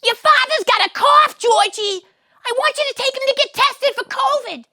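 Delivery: very high, loud shrill mother voice; yelling Estelle. Acted. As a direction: scream down the phone at your son. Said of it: "you can hear them yelling".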